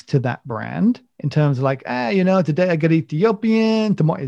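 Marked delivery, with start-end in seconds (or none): 1.8-4.2 higher-pitched mock Italian accent. Coffee roaster talking about his beans, in a mock Italian accent. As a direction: put on a playful Italian accent. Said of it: "I haven't done an Italian accent".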